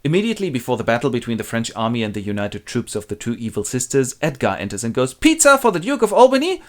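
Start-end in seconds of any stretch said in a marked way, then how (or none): 5.1-6.6 high, cheery sing-song call; pizza delivery guy. Acted. Edgar pretends to deliver pizza to Albany, cheery high call.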